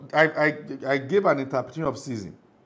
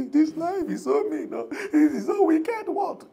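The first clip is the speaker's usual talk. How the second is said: high teary mock sob; mock crying; 13 semitones higher, slower than usual. Preacher mocking the congregation as crybabies, high fake sobbing. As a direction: whimper it like a crybaby.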